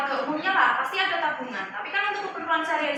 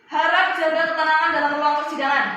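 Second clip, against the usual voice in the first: raised, higher judge's voice cutting in; calling to order; 3 semitones higher, 6 dB louder than usual. Acted. Judge to bickering defendant and witness, loud call to order. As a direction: loudly take control of the courtroom. Judge breaks up courtroom quarrel.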